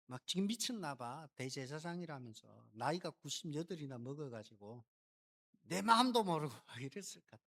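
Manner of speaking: ordinary talk, nothing marked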